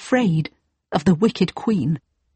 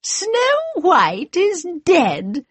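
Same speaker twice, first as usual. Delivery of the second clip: evil queen; slower than usual. Acted. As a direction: savour her triumph slowly. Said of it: "said the wicked queen".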